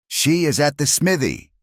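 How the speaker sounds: a townsman calls out where she is, high; crying out. A narrator's reading. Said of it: "another voice cried".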